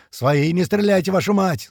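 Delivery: high-pitched warning to comrades; calling out. A narrator's reading. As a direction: call out so your own side holds fire. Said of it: "слышится со всех сторон".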